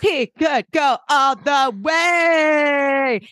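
high, slow drawn-out shout; mock football fan yelling at the TV; mock excited shout. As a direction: stretch it out like a hammed-up fan. [name] cheering at football.

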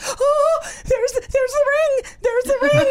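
high, loud, teary cry of joy; imitating a NASA expert overwhelmed with emotion; emotional squeal. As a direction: gush like a scientist overcome. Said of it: "I'm so emotional right now".

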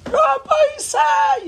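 high, loud scream of a name; calling a name over a noisy hall. Retold or imitated. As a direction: shout the name at the top of your lungs. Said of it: "You're, like, screaming that".

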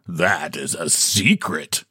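a touch of slow mystery; mysterious. A narrator's reading. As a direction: draw it out like a guarded secret. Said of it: "he said mysteriously".

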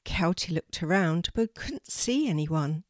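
ordinary delivery, nothing marked

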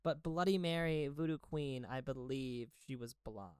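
ordinary talk, nothing marked

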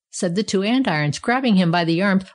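ordinary delivery, nothing marked

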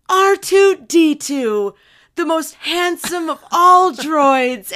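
loud, high, slow proud announcement; grand storyteller droid. Retold or imitated. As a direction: announce the hero with flourish. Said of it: "she said".

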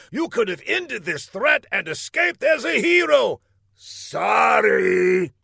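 high, excited showman patter; gloating villain game-show host. A narrator's reading.

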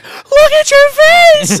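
very high, slow mock scream; imitated horrified shriek. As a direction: shriek it like a mortified onlooker, hammed up. Imitates mortified woman's shriek.

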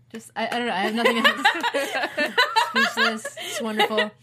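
giggling speechless delight; laugh, lost for words. Retold or imitated. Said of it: "I was just like".

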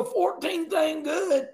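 high, drawn-out mock objection; imitated naysayer. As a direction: stretch it out high, imitating a doubter. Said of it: "just as soon as you go".

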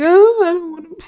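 high, whiny mock-scared voice; scared whine. Teacher mocking her own fear of snowy drives, high whiny whimper. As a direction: whimper it like a scared kid about the snow. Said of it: "I'm like".

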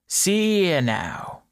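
slow, drawn-out barkeep voice; a barkeep easing a jumpy customer; gruff bartender. A narrator's reading. Bartender speaks to nervous man.

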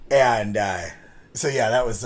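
ordinary delivery

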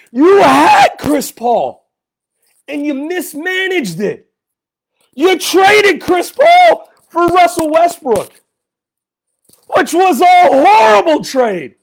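pissed off fan ranting at the front office; angry shouting. Real, not acted. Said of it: "why I'm screaming into the microphone".

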